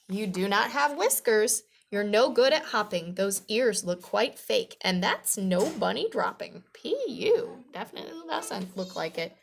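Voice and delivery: put-on lively, projected read-aloud for children; storybook reading. A narrator's reading. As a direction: read it out clearly to young listeners.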